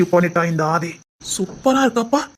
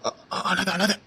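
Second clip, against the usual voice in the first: hushed soothing whisper; whispered comfort; 2 semitones lower, 7 dB quieter, slower than usual. Acted. Young man hushes the crying girl, soft whisper. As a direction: soothe her quietly not to cry.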